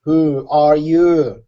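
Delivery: very slow, drawn-out question; speaking slowly. Acted. Tor demands to know who the stranger is, slowly. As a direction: stretch each word right out. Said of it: "He speaks slowly for a second".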